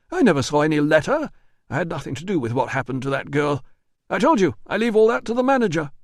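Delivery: high, fast, defensive denial; nervous manager. Acted. Manager denies the letter to Russo, high and rushed. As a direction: talk fast to dodge the blame.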